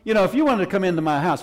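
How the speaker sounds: usual speaking voice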